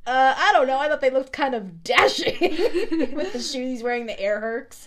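sidekick voice. Retold or imitated. Sidekick answering Hades about the shoes, high and loud. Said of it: "he goes".

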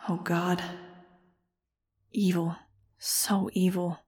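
slow, soft, hushed horror; a quiet, stricken murmur of disgust; heartsick mutter. A narrator's reading. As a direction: mutter it under your breath, sick at heart. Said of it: "I muttered, feeling heartsick".

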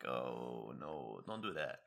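low, soft, dreading groan of a plea. Retold or imitated. Student dreading the review, low and pleading. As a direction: sink low and beg him not to. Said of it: "and I'm like".

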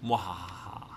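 low mock evil chuckle; sneering panto villain. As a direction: ham up a villain laugh. Mock gloating over rankings.

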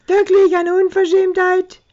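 a high squealing mock of the indignant pensioner; mocking imitation. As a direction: parrot her outrage back at her. Drunk mocking the old couple.

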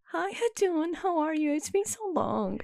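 put-on high, drawn-out American girl voice; mock American-accented greeting. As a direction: mimic the friend in sugary high American. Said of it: "why does she have an American accent".

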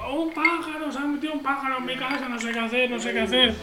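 high, fast, hammed-up panicked squeal; mock panic. To the neighbour about the bird in the house, a mock terrified squeal. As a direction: play the terrified homeowner, over the top.

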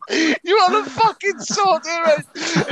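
high-pitched mock outrage; indignant retold retort. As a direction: protest hotly while acting out the row. Retold retort in bus quarrel.

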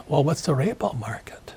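whispered puzzled question; mock-puzzled whisper of an ordinary guy; clueless average guy. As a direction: ask it under your breath, baffled. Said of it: "the average guy goes".

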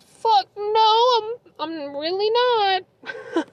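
high, drawn-out mock wail; distressed outburst. Retold answer to worried coworkers, wailing distress.

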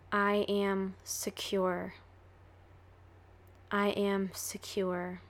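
calm, even affirmations said twice; affirmation reading. Acted. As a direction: speak each affirmation as a quiet promise. Reads repeated self-affirmations.

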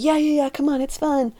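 high, peppy imitation of coaxing friends; mock-enthusiastic friends; eager coaxing. Friends coaxing her onto the ride.

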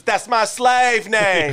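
slow, drawn-out mock line; slow hammed-up movie quote; slow deadpan quote. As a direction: stretch it out deadpan. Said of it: "It was".

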